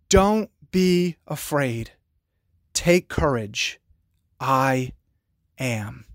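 slow down and comfort them with every word; gentle reassurance. Acted. Preacher giving Jesus' words to frightened listeners, slow and tender.

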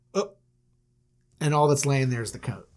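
usual speaking voice

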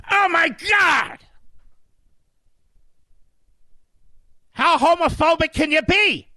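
very high, shrieking mock outrage. Host parodying critics of Florida's law, shrill mock outrage. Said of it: "mocks critics of the law".

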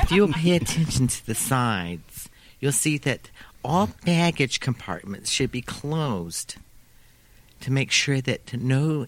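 put-on slow flight attendant with New York accent; drawn-out, lisping New York accent; thickest New York accent. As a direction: read safety instructions in a heavy New York accent. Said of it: "New York accent I've ever heard".

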